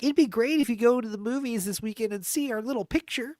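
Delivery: put-on high, sweet little girl. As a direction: ask politely like a small girl. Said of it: "a little girl like".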